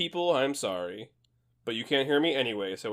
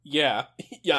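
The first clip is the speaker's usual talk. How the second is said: normal talking voice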